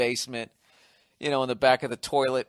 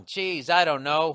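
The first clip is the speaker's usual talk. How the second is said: ordinary talk, nothing marked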